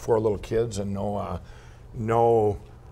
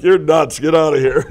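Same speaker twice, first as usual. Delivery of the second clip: loud, higher mock brush-off; mock dismissal; 5 semitones higher, 10 dB louder than usual. Store buyer throwing the inventor out, loud mock scorn. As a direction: play the buyer shooing him out.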